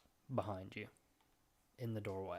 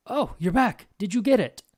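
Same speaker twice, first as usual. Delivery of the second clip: put-on high, loud excited old man; old wizard; 10 semitones higher, 12 dB louder than usual. Wizard calling out to the returning party, high and loud. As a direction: greet them like an eager old scholar.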